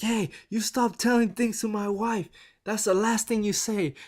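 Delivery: lightly voiced wrestler threatening; mock threat. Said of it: "The Rock's like".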